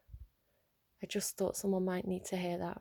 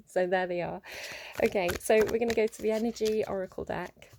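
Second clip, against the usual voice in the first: ordinary delivery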